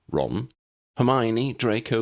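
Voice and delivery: ordinary delivery, nothing marked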